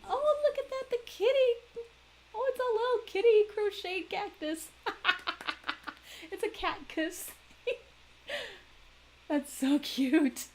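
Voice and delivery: very high, loud squealing over something cute; gushing over a kitty cactus, high and loud; delighted squeal. Real, not acted. Finds cute crochet cactus.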